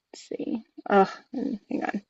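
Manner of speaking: barely voiced, under the breath; muttering to self. Real, not acted. Host hunting on screen mid-live, muttering to self.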